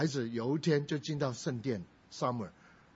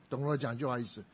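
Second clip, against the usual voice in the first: normal talking voice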